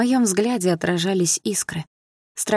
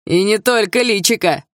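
shouted jeer; 5 semitones higher, 4 dB louder than usual. Acted. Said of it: "выкрикнул ещё какой-то парень".

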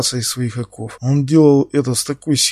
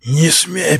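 roaring like a wounded beast; 4 semitones higher, 4 dB louder than usual. A narrator's reading. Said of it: "проревел Иванов раненым зверем".

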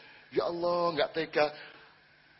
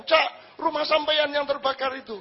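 higher, louder urgent call; calling out alarm; 11 semitones higher, 4 dB louder than usual. Retold or imitated. Running villager tells fisherman his house burns, urgent call. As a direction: shout the bad news across the beach.

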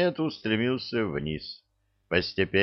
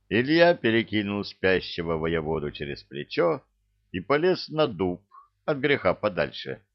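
plain reading voice

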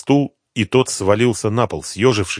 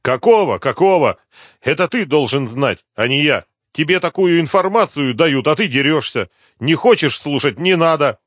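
higher, louder protest; loud indignation; 8 semitones higher, 5 dB louder than usual. A narrator's reading. Addict snaps back at the inspector, loud indignation. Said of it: "громко сказал".

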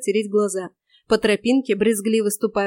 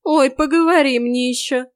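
higher, louder sassy retort; scornful snort; 4 semitones higher, 5 dB louder than usual. A narrator's reading. Forest girl rebuffing the interfering man, snorting scorn. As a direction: snap back with derision. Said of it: "фыркнула девица".

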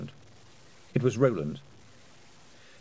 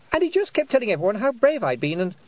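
high, louder insistent protest; flustered boy; 11 semitones higher, 5 dB louder than usual. A narrator's reading. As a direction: keep pushing the explanation out anxiously.

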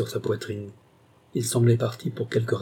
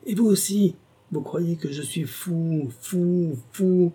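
much higher, slow, furious insistence; raging at Alan; 7 semitones higher, slower than usual. A narrator's reading. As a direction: hammer the word fou in rage. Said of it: "sa colère se rua encore sur Alan".

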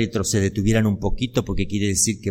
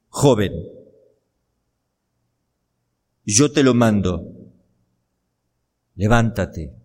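mandato firme, con largas pausas; orden solemne; 4 dB louder than usual. Acted. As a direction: ordena con autoridad, dejando pesar cada frase. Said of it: "Da una orden". (mandato solemne y pausado)

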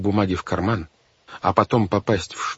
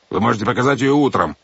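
raised, irritated refusal; angry; 4 semitones higher, 5 dB louder, faster than usual. A narrator's reading. Captain loses patience with the children, angry. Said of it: "разозлился капитан Шем".

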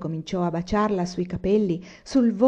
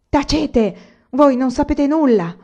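higher, louder furious outburst; young man snapping at his elders; angry outburst; 7 semitones higher, 8 dB louder, slower than usual. A narrator's reading. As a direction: burst out hot with anger. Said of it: "proruppe adirato e infiammato".

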